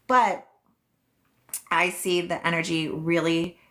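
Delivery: ordinary delivery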